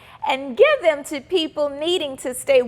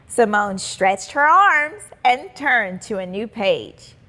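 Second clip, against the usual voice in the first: ordinary delivery, nothing marked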